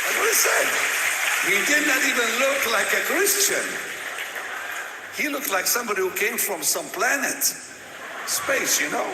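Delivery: booming preacher's voice, very loud and high; full-force preaching. Real, not acted. Preacher telling the crowd about his nurse, booming.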